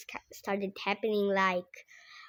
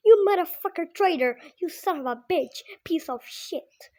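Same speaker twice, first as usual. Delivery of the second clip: fast, higher, louder furious rant; angry dad yelling; 7 semitones higher, 6 dB louder than usual. Retold or imitated. Dad yelling at the mom for cheating, angry rant. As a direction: spit the insults out fast.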